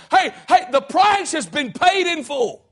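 shouted, slowed "paid in full"; preacher's shout. Acted. Pastor declaring redemption, shouting slowly. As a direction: call it out to the back pews.

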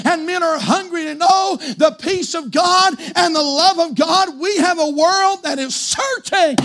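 high, loud shouted preaching; full-force preaching. Acted. Preacher shouting to the congregation, full force. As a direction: build to a roaring climax.

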